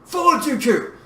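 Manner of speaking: loud, high command; urgent forceful call; shouted order. A narrator's reading. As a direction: yell the order to the pilot. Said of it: "shouted at the pilot".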